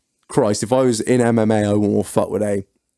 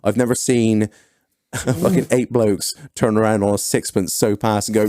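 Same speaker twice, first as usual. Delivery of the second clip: usual speaking voice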